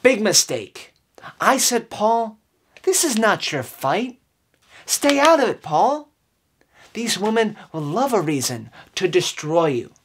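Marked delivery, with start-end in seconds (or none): none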